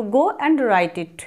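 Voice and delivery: very slow, every word separated; patient dictation pace; slow model phrase. Shown as a demonstration. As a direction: draw "Go and write it" out for the class. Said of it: "এইভাবে বলো".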